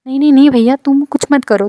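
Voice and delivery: a firm no, nearly shouted; turning down a creepy stranger's offer, loud and high; loud refusal. Retold or imitated.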